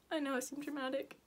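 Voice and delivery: soft, tearful voice; fighting back tears; crying. Real, not acted. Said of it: "I don't know why I'm crying".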